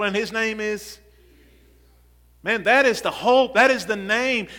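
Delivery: loud, insistent church exhortation; fired-up preaching. Real, not acted. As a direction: preach it with rising urgency to the congregation. Preaches at full force.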